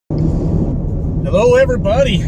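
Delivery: bright, rising call to the viewers; cheery hello. Real, not acted. Greeting viewers from the car.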